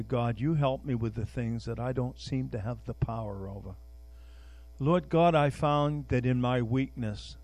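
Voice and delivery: soft, low prayer voice; preacher closing in prayer, soft and low. Acted. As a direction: pray quietly to God. Leads a prayer.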